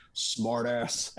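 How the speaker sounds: lightly put-on big man's voice. Guitar teacher to the student playing his solo, mock big voice. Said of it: "in his big voice".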